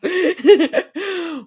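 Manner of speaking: a high burst of laughter; laughing. Real, not acted. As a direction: laugh at the joke. Laughing after the fake-cough tease.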